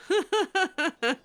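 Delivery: high, loud real laugh; embarrassed laugh. Real, not acted. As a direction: crack up at the cheeky line. Laughing at Daveth's flirt.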